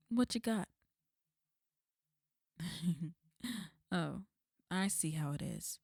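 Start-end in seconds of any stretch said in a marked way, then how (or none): 2.6-3.1 brief scornful laugh; mocking chuckle. Villain scoffing at the group's offer with a chuckle. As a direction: laugh off their pitiful offer.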